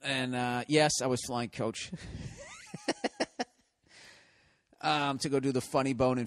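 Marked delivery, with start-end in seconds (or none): 2.0-3.4 high squealing laugh. Real, not acted. Laughs at flying coach.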